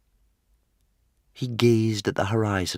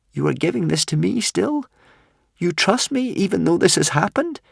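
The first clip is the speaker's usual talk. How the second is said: high, louder pleading questions; incredulous young hero; 12 semitones higher, 5 dB louder than usual. A narrator's reading. Tearful Hiccup asks if dragon still trusts him, high disbelief. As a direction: ask it high and full of disbelief.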